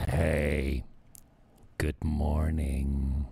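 low, slow half-whispered morning greeting; groggy morning voice. Retold or imitated. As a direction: mumble it like you just woke up. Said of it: "How about that for morning breath?".

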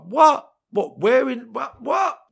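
shrieking high, loud outrage; high furious yells at the door; furious screaming. Retold or imitated. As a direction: scream it like you've just been wronged. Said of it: "A lot of profanity, swearing, screaming".

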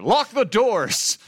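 high, loud, drawn-out imitation of a madman; crazy shouted command. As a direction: holler it like a crazed restaurant owner. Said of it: "has this crazy speech, and he's like".

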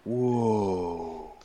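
stunned, letting the word hang; shocked. Acted. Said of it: "he's shocked".

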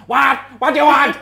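high, loud, impatient cook; curt egg-hatch cook. Retold or imitated. Hidden cook demanding a guest's order, high and loud. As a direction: bark the question back, baffled. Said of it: "this little voice going".

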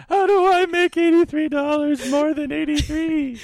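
high, loud mock whine; panicked broke friend. Teasing co-host imagining her crying over banking, high mock whine. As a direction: squeal the money question in despair. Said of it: "And you were just like".

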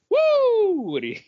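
usual speaking voice

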